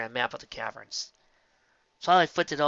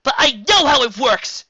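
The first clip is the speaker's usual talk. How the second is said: high, loud, slow exclamation; exclaimed; 13 semitones higher, 15 dB louder than usual. A narrator's reading. Twilight exclaiming to Spike. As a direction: burst out with it. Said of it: "she exclaimed".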